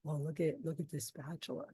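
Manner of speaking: soft, low hushed voice; imitating a shy hushed pitch. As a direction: quietly show off the spatula. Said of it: "He's like".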